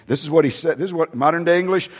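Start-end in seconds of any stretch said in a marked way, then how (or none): none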